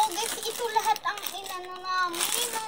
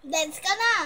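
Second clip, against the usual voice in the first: loud; 9 dB louder than usual. Real, not acted. Urging her friend to dance.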